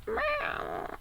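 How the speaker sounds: high, soft, drawn-out meow; heartbreaking cat meow. Retold or imitated. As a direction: imitate an old cat's pitiful meow, quietly. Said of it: "she does this meow that's so, it just breaks my heart".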